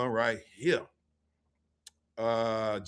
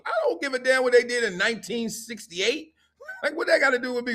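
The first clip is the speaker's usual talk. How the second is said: high, laughing scoff; scoffing at the handout; 9 semitones higher than usual. Retold or imitated. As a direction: brush off the old history with a laugh. Mocking the corny team history.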